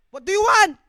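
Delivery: big projected question; acted loud question. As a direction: call the question out to someone in a crowd. Acts out Jesus answering the beggar.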